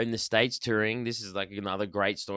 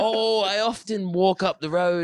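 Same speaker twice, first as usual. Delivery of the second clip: English accent, lightly posh impression; 9 semitones higher, 4 dB louder than usual. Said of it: "that's how he sounded".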